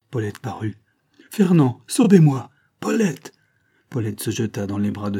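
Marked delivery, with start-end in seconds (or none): 1.3-3.3 high, loud cries on sight; urgent outcry. A narrator's reading. Captive girl and lieutenant reunited mid-duel, urgent outcry. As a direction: cry out to the loved one. Lovers cry out as door opens.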